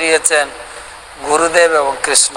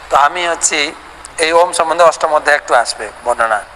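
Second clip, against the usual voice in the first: usual speaking voice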